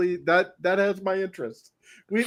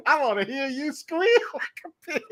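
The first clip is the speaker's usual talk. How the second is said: mock hillbilly threat; 7 semitones higher than usual. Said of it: "goes all deliverance on us".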